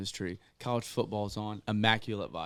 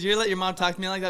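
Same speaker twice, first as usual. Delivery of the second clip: high, loud, fast imitation of an offended girl; indignant confrontation; 10 semitones higher, 7 dB louder, faster than usual. Girl insulted by his mom, indignant confrontation. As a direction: fire the question at him, offended.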